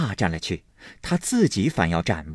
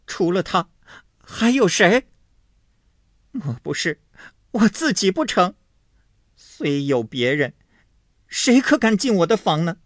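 high, louder, mocking sneer; cold sneer; 10 semitones higher, 4 dB louder than usual. A narrator's reading. Wife sneering at her husband, high and cold. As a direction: scoff at him with cold contempt. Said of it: "金贵冷笑道".

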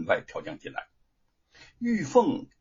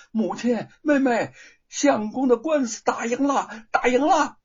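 higher and louder, rising to a cheer; loud and overjoyed; 10 semitones higher, 5 dB louder than usual. A narrator's reading. Sister running in to announce the victory, shouting with joy. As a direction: burst in shouting the good news. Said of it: "大声地说".

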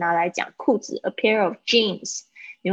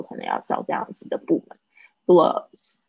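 ordinary delivery

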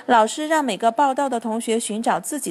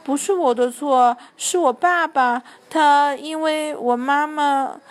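put-on slow, halting boy's excuse; a hesitant, slow-stumbling excuse; stammering hesitantly; 3 semitones higher, slower than usual. A narrator's reading. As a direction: drag the words out unsure. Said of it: "男生吞吞吐吐地说".

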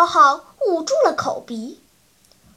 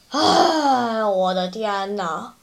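exasperated sigh falling away in pitch; long sigh; 5 semitones lower, slower than usual. A narrator's reading. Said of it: "长出了一口气".

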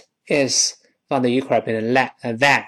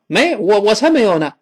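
higher, protesting reply; indignant denial; 6 semitones higher, 4 dB louder than usual. Acted. Second speaker denying they nearly drowned, protesting. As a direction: brush off the accusation.

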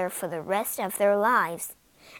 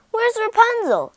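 loud, much higher demand; demanding shout; 11 semitones higher, 8 dB louder than usual. Acted. Prince demanding of the witch where Rapunzel is, loud. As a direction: demand an answer at once. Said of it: "he demanded".

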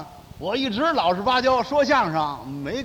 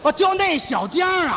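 mock surprise; 7 semitones higher than usual. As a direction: act like it just clicked, overdone. Feigned realization in crosstalk. (夸张地恍然大悟)

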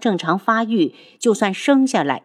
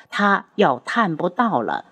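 usual speaking voice

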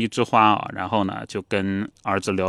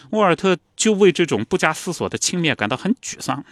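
ordinary talk, nothing marked

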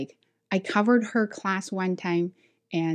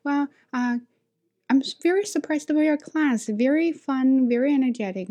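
surprised boss; 7 semitones higher than usual. Retold or imitated. Boss after watching the class, retold surprise. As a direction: imitate someone haltingly impressed. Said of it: "And he was surprised".

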